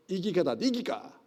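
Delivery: broad Gyeongsang accent, quick and clipped-sounding; Gyeongsang dialect. Shown as a demonstration. Pastor showing the class old dialect, Gyeongsang accent. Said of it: "경상도 본토 발음이에요".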